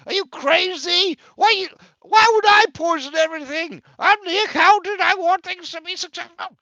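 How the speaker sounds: high, shrieking indignant tirade; outraged shouting. Acted. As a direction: explode at the one who betrayed you, loud and high. Enraged Quay berates the guard.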